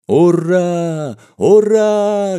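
high, loud drawn-out hurrah; full-throated drunken cheer. Acted. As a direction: cheer like a rowdy drinking crowd. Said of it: "горласто закричали пьяницы". (горластый пьяный крик)

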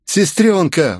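loud, high joyful cry; exclaiming. A narrator's reading. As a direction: call out to her in relief. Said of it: "воскликнул Сакамото".

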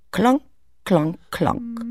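high, ringing klonk klonk klonk; echoing stone drops; klonk sounds. Acted. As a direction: imitate stones plunking into water. Imitating stones falling into water.

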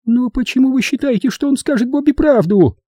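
very high, challenging woman's voice; shrill high-pitched objection; Edvina's piercing falsetto. A narrator's reading. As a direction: question him in a piercing falsetto. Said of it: "раздался пронзительный фальцет Эдвины".

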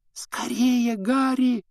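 put-on high, urgent girl's plea; lightly voiced urgent whisper; mouthed whisper. A narrator's reading. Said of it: "одними губами прошептала".

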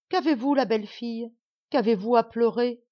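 a nasty little dwarf feigning concern; voix du nain. Acted. Dwarf addressing princess. (voix aiguë du petit nain)

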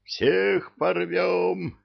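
high, slow devilish roar; roaring devils. A narrator's reading. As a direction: roar it out like a gleeful mob of devils. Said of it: "ревели черти". (ревущие черти)

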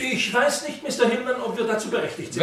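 high, hesitant, hurried at the end; nervous and high. Acted. Nervous businessman, unsure he may answer the inspector, high and hurried. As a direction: rush the doubt out nervously.

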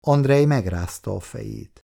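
usual speaking voice

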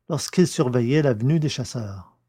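plain reading voice

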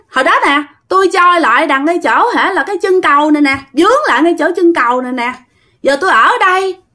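put-on high girlish voice, pointing at the bridge; ghost girl voice. Ghost girl telling the medium where she drowned, in a high voice.

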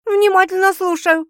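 high, loud and teasing; amused. A narrator's reading. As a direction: pipe up with a chuckle in mind. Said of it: "хохотнула Светка". (весело, высоко и громко)

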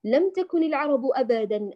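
usual speaking voice

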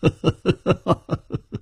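high, rippling laugh in a run of ha-has; hearty ha-ha laugh. Acted. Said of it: "楚留香只是哈哈一笑".

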